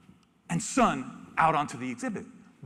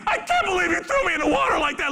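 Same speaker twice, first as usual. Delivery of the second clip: high, loud outraged whine; mock indignant cub; 12 semitones higher, 5 dB louder than usual. Cub scolding keepers who threw it in, mock outrage. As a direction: complain like a betrayed kid. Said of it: "you look at his face".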